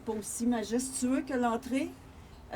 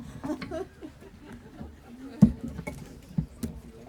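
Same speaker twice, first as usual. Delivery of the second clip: high, real laughing; amused laugh; 8 semitones higher than usual. Real, not acted. Speaker after joking about her heels, amused laughing. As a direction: laugh after your own quip.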